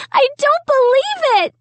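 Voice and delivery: high shriek falling at the end; still shrieking. A narrator's reading. Sarah keeps shrieking over the paint mess, falling shriek. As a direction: shriek again, landing it hard. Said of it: "Sarah kept shrieking".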